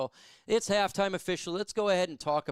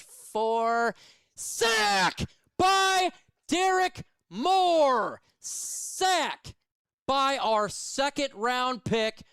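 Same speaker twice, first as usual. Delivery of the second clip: much higher and louder, slow drawn-out shouting; ecstatic sack call; 7 semitones higher, 5 dB louder, much slower than usual. Real, not acted. Fan calling his team's sack on stream, ecstatic drawn-out shout. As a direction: yell the sack like the stadium just erupted.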